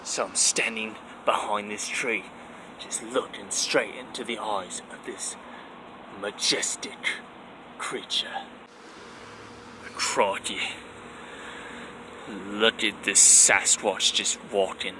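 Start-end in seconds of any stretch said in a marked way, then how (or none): 0.0-10.6 soft whispered wildlife host; hushed nature documentary. Acted. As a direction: hide and narrate under your breath. Hiding behind a tree.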